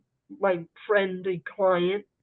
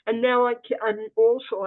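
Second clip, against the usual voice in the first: ordinary talk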